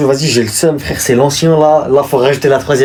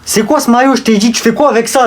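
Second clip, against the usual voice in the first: high-pitched indignant demand; angry confrontation; 9 semitones higher than usual. Acted. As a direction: challenge him sharply about the shirt. Angry challenge over shirt. (reproche indigné)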